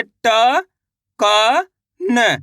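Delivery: "ट", "क", "न" stretched singly with pauses; slow separate sounds "ट, क, न"; letter by letter. Shown as a demonstration. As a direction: sound out "ट, क, न" one drawn-out letter at a time. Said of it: "तो आप कैसे पढ़ते".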